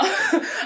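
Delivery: short high burst of laughter; real amused giggle. Real, not acted. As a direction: giggle at your own cheek. Laughs at own remark.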